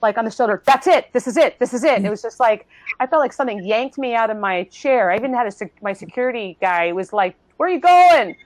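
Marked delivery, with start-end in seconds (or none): 0.7-1.9 high, loud, insistent exclamations; an insistent voice at the shoulder, pitched up; urgent prompting. Retold or imitated. Holy Spirit urging the speaker at the event, imitated urgency. 7.6-8.4 high, loud imitated shout; alarmed calling out. Security guy calling after the speaker, imitated alarmed shout.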